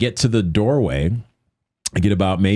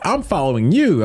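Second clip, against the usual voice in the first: raised, high-pitched imitation of an eager ally; imitated call; 9 semitones higher than usual. Masked man to the speaker at the rally, imitated call.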